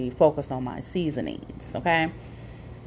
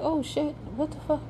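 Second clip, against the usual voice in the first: startled swear; 11 semitones higher, 5 dB quieter than usual. Retold or imitated. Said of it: "I was like".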